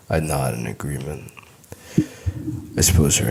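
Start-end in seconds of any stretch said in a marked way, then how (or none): none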